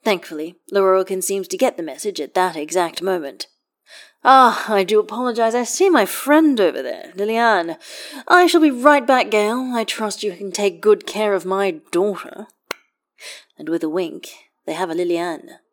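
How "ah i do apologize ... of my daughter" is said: higher, louder, oily and showy courtly voice; a smug host excusing himself grandly; pompous wizard host. A narrator's reading. Lorroakan pawning his daughter off on Gale, showy and loud.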